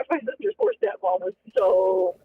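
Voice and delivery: ordinary talk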